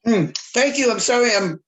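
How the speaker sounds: talking while chewing; words round a bite of dinner; mouth full. Real, not acted. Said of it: "chewing on my dinner".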